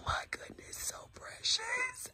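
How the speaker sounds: high, slow breathless whisper of delight. Real, not acted. Cooing over the baby.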